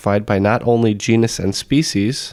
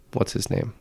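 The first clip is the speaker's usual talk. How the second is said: whispered; 9 dB quieter than usual. Acted. Quiz question said softly.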